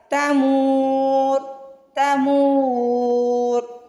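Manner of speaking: तमूर with a long drawn मू; stretched mim. Shown as a demonstration. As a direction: pull out the मू of तमूर before the र. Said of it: "पहले आप मीम को खींचेंगे". (मीम को खींच कर)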